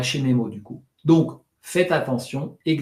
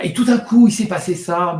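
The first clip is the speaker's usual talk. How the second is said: suddenly much higher and louder, full of life; lively burst; 5 semitones higher, 7 dB louder than usual. Shown as a demonstration. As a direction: jolt the listener awake. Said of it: "mettre un peu de vie".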